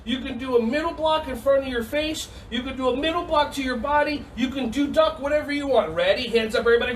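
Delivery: ordinary talk, nothing marked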